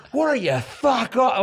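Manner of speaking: high, drawn-out re-enacted outburst; going for it. Comic re-enacting his outburst at Piers Morgan, going for it. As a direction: go all in on a slow, high-pitched taunt. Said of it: "I went for it like that".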